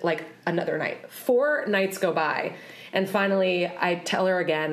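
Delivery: ordinary talk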